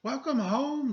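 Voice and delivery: high, bright-eyed greeting sung out; cheery welcome. A narrator's reading. Mother welcoming Chrysanthemum home, high and cheerful. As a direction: greet her like she's the best thing all day. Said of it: "said her mother".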